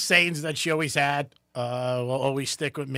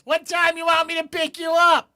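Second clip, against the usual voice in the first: much higher, louder mom voice; mom calling out; 7 dB louder than usual. Retold or imitated. Mom calling from the car to her son, high and loud. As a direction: sing out like a cheerful mom. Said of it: "she goes".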